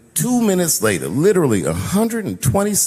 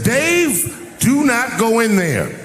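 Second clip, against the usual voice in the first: urgent warning shout; 8 semitones higher, much slower than usual. Retold or imitated. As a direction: shout to stop him going in. Producers warning him urgently.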